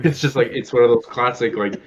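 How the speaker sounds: ordinary talk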